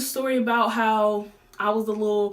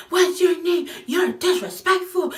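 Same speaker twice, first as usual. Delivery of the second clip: high, louder scolding grown woman; mock scolding woman; 8 semitones higher, 4 dB louder than usual. Retelling the woman scolding Susie, high and sharp. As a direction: raise the pitch and scold like an offended adult.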